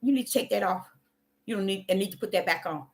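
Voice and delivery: soft, hushed correcting voice; gently but firmly tell yourself to stop; quiet inner voice of the Spirit. Retold or imitated. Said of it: "He said".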